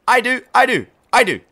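put-on volunteer like an eager kid.

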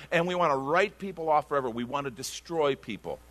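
ordinary talk

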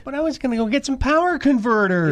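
put-on high, whiny farm-boy complaint; Luke Skywalker whine. Quoting Luke Skywalker line.

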